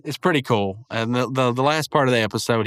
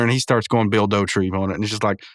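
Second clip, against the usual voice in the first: ordinary delivery, nothing marked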